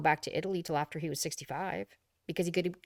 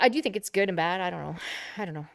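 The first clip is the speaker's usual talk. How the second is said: ordinary talk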